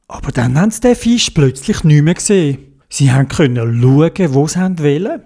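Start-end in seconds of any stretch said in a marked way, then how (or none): none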